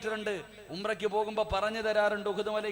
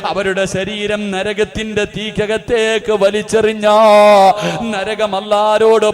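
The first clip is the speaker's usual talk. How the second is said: loud, chanting sermon cry with long-drawn endings; impassioned preaching; 4 semitones higher, 24 dB louder than usual. Acted. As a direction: preach at full force, stretching the last word of each phrase. Preaches at full force. (ഉച്ചത്തിൽ ഈണത്തിലുള്ള വികാരഭരിത പ്രസംഗം)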